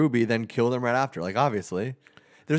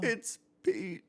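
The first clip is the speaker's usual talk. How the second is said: high, weak whimper; hammed-up defeated squeak; broken surrender; 12 semitones higher, 7 dB quieter than usual. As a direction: give in with a small high-pitched whimper. Whipped man gives in.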